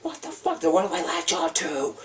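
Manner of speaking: high panicked plea; freaked-out high cry. Retold or imitated. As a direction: cry out high like you're losing grip. Retold panic, mind reeling.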